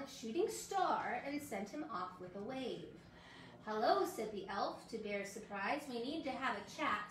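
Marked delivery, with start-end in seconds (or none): none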